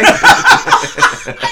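squealing high laugh; high cackle. Real, not acted. Laughing at her own outrageous joke, squealing. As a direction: burst into a shrieking laugh.

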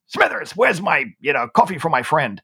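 mock shout easing toward normal voice; shouted demand. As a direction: imitate a bossy call to the barista. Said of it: "shouted at the barista again".

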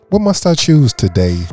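ordinary delivery

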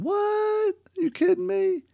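very high squeaky shocked voice; stunned disbelief. Retold or imitated. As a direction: shriek out the disbelief like a stunned caller. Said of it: "And the guy's going".

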